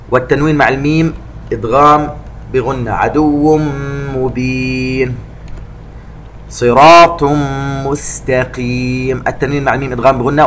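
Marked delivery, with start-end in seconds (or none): 2.9-9.2 slow, elongated tajweed chanting; Quran recitation. Shown as a demonstration. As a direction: recite it slowly and melodically. Recitation demo. (تلاوة مجودة بطيئة)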